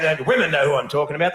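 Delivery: ordinary delivery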